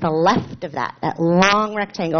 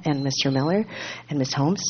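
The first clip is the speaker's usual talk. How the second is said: ordinary talk, nothing marked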